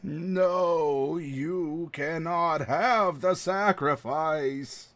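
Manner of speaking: very slow, drawn-out creature voice; hive-mind creature. Acted. Guardian creature refusing to move, slow droning voice. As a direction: stretch every word into an eerie chant.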